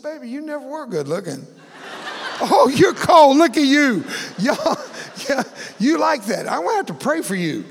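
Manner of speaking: high, loud laughing banter; wife teasing her burned husband, laughing. Retold or imitated. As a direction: tease him while laughing. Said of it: "We both laughed".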